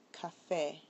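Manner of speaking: FÉ stressed in café. Shown as a demonstration. Teacher shows a word that breaks the rule, café stressed on FÉ. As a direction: say café with the weight on its final syllable, FÉ. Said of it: "it's actually on the E".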